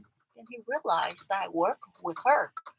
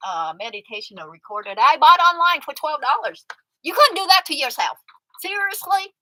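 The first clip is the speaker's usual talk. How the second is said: exasperated rant; 6 semitones higher, 10 dB louder than usual. Real, not acted. Ranting at friend.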